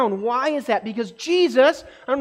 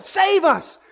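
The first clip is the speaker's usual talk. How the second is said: cry for help; 7 semitones higher than usual. Retold or imitated. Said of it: "a cry for help".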